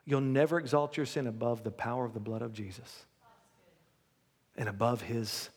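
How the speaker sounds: low, hushed altar-call preaching; quiet preaching. Acted. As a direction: draw them in softly and low. Softens to intimate altar call.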